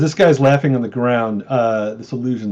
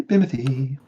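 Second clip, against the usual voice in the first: ordinary delivery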